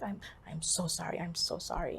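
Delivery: soft, helpless apology; hushed apology. Retold or imitated. To the woman seeking her husband, hushed apology. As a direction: drop to a soft, repeated apology.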